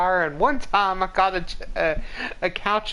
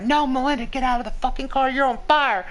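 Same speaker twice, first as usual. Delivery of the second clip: higher-pitched, frantic retold alarm; urgent warning; 5 semitones higher than usual. Friends warning her to flee the car, urgent and high. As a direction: imitate friends desperately getting through to her.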